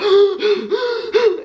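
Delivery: mimicked gasps for air; imitated gasping. Retelling being on the floor after swallowing water, imitated gasps. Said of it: "I was on the floor like".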